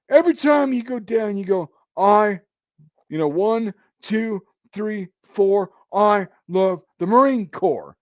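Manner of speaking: high, loud, drawn-out count; slow barked chant with gaps between counts; drill sergeant's orders. Retold or imitated. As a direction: call out a push-up cadence like a sergeant. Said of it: "my drill sergeant".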